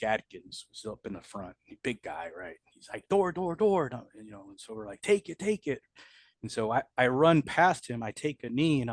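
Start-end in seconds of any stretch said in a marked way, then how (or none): 3.1-3.9 higher urgent call of the word door. Retold or imitated. Soldier calling out in firefight. 5.0-5.8 much higher, urgent urging; urgent call. Retold or imitated. Squad urging during firefight.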